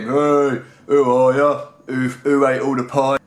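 rowdy mock football-lad chant; drunken lads' cheering. English lad's mates applaud him, rowdy chanting. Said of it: "all his mates applaud him".

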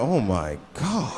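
low, drawn-out disbelief; lightly voiced appalled wife; shocked wife. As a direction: slowly stretch out the shock, dropping low. Acting the shocked magazine reader's wife.

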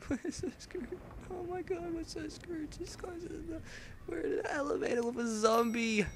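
high, strained laughing panic; panicked squeal. Real, not acted. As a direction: squeal in mock despair. Panicking in elevator.

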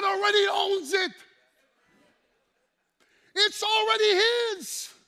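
high loud outraged cry; indignant preaching shout. Acted. Preacher outraged the devil offers what Jesus owns, shouting. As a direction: rage at the absurd offer.

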